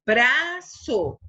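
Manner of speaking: BRAÇO split into bra and ço; stretched, divided "braço"; syllable by syllable. Shown as a demonstration. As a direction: say "braço" in two separate beats. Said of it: "vão sempre acompanhadas de uma vogal".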